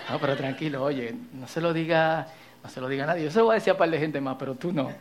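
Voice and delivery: low, hushed gossip; tittering gossip whisper. Retold or imitated. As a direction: spill the secret softly. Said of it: "Tono de chisme".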